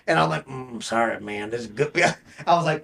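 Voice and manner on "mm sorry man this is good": low Sling Blade character mumble; Billy Bob Thornton. Retold or imitated. Still apologizing in Home Depot, stuck in Sling Blade voice. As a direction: drop into the Southern movie-character voice. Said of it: "I couldn't stop talking like that".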